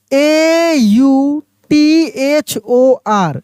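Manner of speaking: letters A U T H O R spelled very slowly; slow spelling out. Shown as a demonstration. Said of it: "कैसे पढ़ते हैं इसको".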